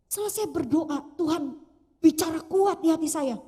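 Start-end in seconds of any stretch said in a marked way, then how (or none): none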